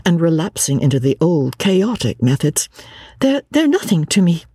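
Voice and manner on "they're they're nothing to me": high, faltering protest; girl backing down, voice pitched up; losing courage. A narrator's reading. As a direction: stumble into a weak, high-pitched denial. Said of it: "losing her courage".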